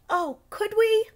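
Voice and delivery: put-on child thrilled at a field trip; eager. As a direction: beg with excitement.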